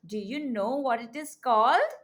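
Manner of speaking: ordinary talk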